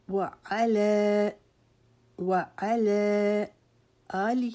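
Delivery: وعلی chanted very slowly with a long آ; slow, melodic وعلی; tajweed recitation. Shown as a demonstration. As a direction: stretch the آ in وعلی like a recited prayer. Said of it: "آ کو لمبا کریں گے".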